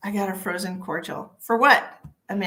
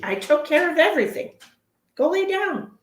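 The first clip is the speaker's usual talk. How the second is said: high, raised-voice telling-off; exasperated scolding; 9 semitones higher, 5 dB louder than usual. Real, not acted. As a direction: scold the dog, pitch climbing sharply on the final order. Scolding whining dog, escalating.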